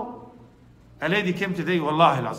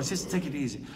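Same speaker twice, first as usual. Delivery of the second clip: soft, much quieter Sister, take it easy; gentle comforting; 4 semitones lower, 10 dB quieter, faster than usual. Retold or imitated. Imam softly comforting the crying mother. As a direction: soothe her gently, almost hushed.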